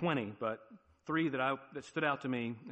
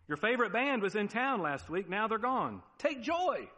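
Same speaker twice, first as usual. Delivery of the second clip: high, louder, hammed-up cheer; mock exhortation; 8 semitones higher, 5 dB louder than usual. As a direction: announce bad news as if it were joyful. Acts out an absurd announcement.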